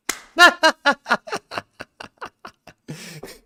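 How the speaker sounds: long, loud disbelieving laugh up high. Real, not acted. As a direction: burst out laughing at a ridiculous idea. Laughs at fans' Naroki idea.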